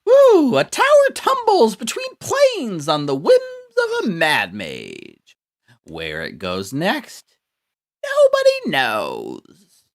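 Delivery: shrieking high manic voice; crazy voice. Acted. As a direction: shout it like an unhinged villain. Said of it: "my crazy voice".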